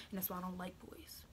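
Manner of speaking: whispered punchline; soft, unvoiced whisper; whispered aside. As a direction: softly confide it.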